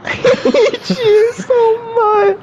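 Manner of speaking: high, tearful-sounding wail through laughter; slow squealed exasperation; tweaking out. Real, not acted. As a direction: whine it helplessly, half laughing, half crying. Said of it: "I don't know what he's tweaking about".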